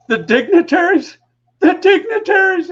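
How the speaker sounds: high, loud mocking repeat, cracking up; mocking the dignitaries in a high laughing voice. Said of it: "starts falling all over himself laughing".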